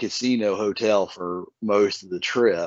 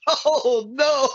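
mock dismay. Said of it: "I'm sitting there like".

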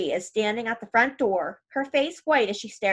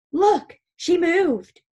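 high, loud, slow astonished cry; thrilled boy sure the body moved; boy whispering loudly at the wake that she moved; excited boy; 7 semitones higher, 5 dB louder, much slower than usual. Acted. Boy sees corpse move.

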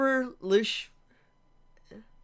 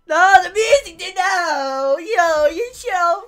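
high, drawn-out, pained cry; real tearful wail; 5 semitones higher, 6 dB louder, slower than usual. Real, not acted. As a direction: wail as the sad music hits you. Sad music hits him.

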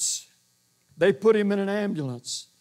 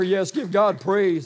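usual speaking voice

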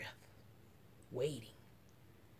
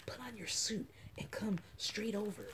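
breathy secret whisper; 3 semitones higher, 9 dB quieter than usual. A narrator's reading. Girl whispering to her friend at the door. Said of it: "I whisper".

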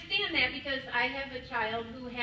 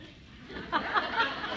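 self-conscious laugh; 8 semitones higher, 3 dB louder than usual. Real, not acted. Laughs at the Michigan nasal remark.

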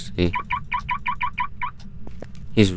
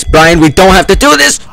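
loud, high desperate plea; panicked yelling; 7 semitones higher, 6 dB louder than usual. Real, not acted. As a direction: beg in terror, yelling. Pleading with ghost, yelling.

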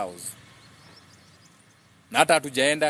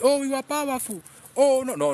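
much higher, hammed-up proud storyteller; mocking boastful voice; 11 semitones higher than usual. Speaker mocking the story handed to Africans, higher and hammed up. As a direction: ham up the fake proud story they sell you. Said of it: "they give you a story to run with".

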